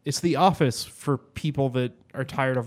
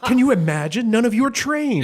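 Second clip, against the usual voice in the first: much higher, louder, theatrical diva scoff; Moira impression; 11 semitones higher, 6 dB louder than usual. Moira scoffing at her untrained family, high and loud. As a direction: play the grand failed actress. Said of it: "And she goes".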